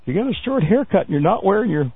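usual speaking voice

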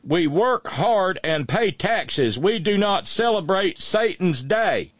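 high, slow hammed-up Southern dialect; mock dialect reading. Host reads the rest of the sign in a slow mock Southern dialect. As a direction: drag it out high like a smug Southern homeowner. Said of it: "so I'm gonna read it in dialect".

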